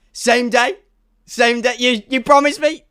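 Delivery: loud, rising, eager questions; hopeful excitement. Acted. Traveler begging friend for reassurance, excitedly hopeful.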